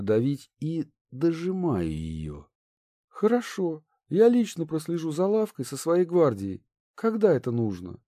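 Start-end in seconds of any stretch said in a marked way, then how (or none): 3.1-8.0 put-on lightly higher, poised young noblewoman; a polite princess, lightly voiced. A narrator's reading.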